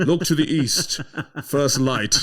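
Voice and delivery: high, slow, grand film hero call; hammed-up epic rescue line; Gandalf at Helm's Deep. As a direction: proclaim it like the wizard arriving at dawn. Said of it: "Lord of the Rings two at Helm's Deep".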